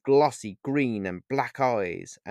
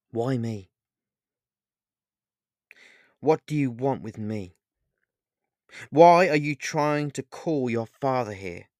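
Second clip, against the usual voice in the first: mildly angry questioning, building louder; 2 semitones higher, 4 dB louder than usual. A narrator's reading. Paul confronts Pan about calling his father, mildly angry. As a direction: start contained, push harder into the last demand. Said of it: "asked Paul angrily".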